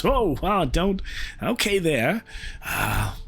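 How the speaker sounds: high, startled dwarf voice. Acted. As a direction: jumps back, startled.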